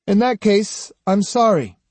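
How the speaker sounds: high, airy non-apology; blithe. A narrator's reading. Swordsman apologising to the man he offended, blithely. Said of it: "said Kojiro blithely".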